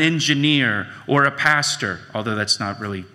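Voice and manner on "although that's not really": lower, softer, quick throwaway; wry aside. Real, not acted. Pastor joking about himself to the congregation, wry and quiet. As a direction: toss it off with a shrug.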